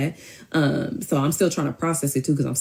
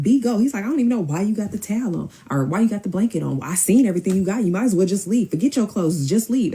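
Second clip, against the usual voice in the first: high, agitated shooing; retold impatient dismissal; 6 semitones higher than usual. Man telling the girl to leave, impatient mock agitation. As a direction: imitate him pushing her out, pitched up.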